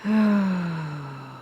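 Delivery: long, falling sigh; deep breath. Acted. Storyteller acting out calming breaths before being touched, long deep sigh. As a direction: let out one long, deep exhale. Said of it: "I took a few deep breaths".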